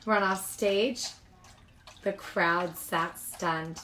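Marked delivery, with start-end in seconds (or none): none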